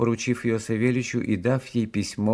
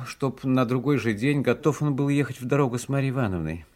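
ordinary delivery, nothing marked

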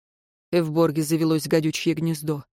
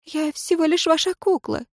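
put-on despairing young woman; 10 semitones higher than usual. A narrator's reading. Ireyn's despairing line.

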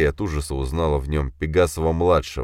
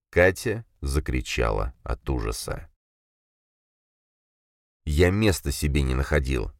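plain reading voice

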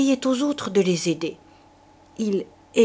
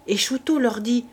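ordinary delivery

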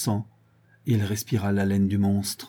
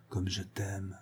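much softer, almost gone; fading murmur; 2 semitones lower, 14 dB quieter, slower than usual. A narrator's reading. Villain repeating his love to the captive, fading away. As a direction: let it die away to nothing. Repeated, second take fades.

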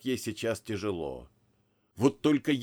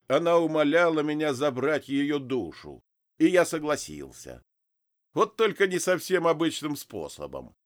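voiced Adam; 5 semitones higher, 4 dB louder than usual.

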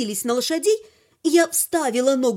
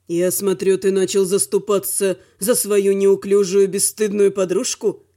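low male voice, scornful; haughty, low-pitched jibe; sneering dragon; 6 semitones lower than usual. A narrator's reading. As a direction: taunt in a lowered voice. Velor sneers at his brother.

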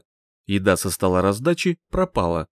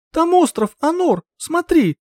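much higher, squealing with excitement; childlike delight; 5 dB louder than usual. Acted. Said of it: "детскую радость... взвизгнула". (детский восторг)

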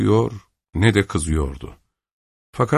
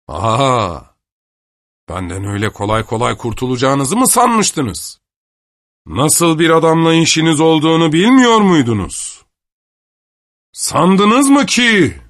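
higher, louder gloating, stretched out at the end; triumphant taunt; 8 semitones higher, 5 dB louder than usual. Acted. Avdey gloats at Kister after challenging him to a duel, loud and taunting. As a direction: crow over him, drawing out the last words.

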